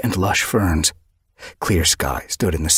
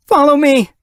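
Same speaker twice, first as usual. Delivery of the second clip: urgent call; 8 dB louder than usual. Acted. Sparrowfur leads the run.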